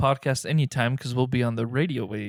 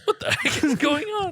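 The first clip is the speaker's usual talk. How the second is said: startled shopper; 14 semitones higher than usual. Retold or imitated. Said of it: "people will be like".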